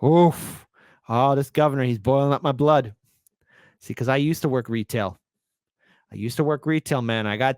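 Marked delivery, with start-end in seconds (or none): none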